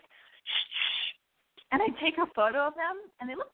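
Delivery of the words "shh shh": imitated camera shutter shh; shutter sound. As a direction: make the phone click sound. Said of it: "so it's like".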